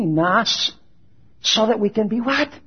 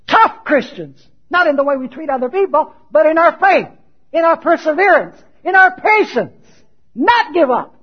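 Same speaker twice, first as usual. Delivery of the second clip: raised, punched list from the pulpit; forceful preaching; 7 semitones higher, 7 dB louder than usual. Acted. Preacher calling Christians to be tough in faith, forceful. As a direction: hammer each phrase higher and louder.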